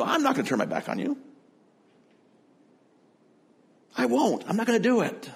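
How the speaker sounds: high, fast, brash protest; impulsive Peter. Retold or imitated. Peter brashly swearing loyalty to Jesus, high and fast. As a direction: blurt it out like cocky Peter. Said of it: "impulsively responds as he always does".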